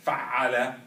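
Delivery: Fa'ala called out loud; loud model word. Shown as a demonstration. Teacher calling the pattern word for students to fill. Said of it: "Give me three syllables".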